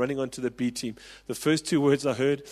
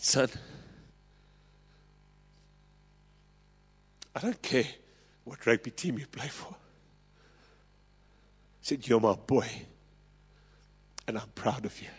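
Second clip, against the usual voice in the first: softer, gentle words; tender father; 4 dB quieter than usual. Retold or imitated. Father comforting his dropped son after the match, soft and tender. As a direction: speak it softly, hand on his head.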